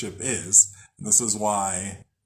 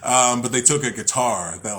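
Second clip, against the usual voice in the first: usual speaking voice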